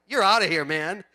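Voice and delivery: sudden loud burst, mock ejection; acted shouted dismissal. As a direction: throw him out loudly, hammed up for laughs. Acting out throwing the man out.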